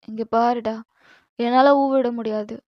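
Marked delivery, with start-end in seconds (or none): none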